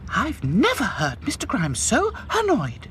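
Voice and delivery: high, prim doll voice; Princess. A narrator's reading. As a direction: gossip in the shed. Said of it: "said Princess".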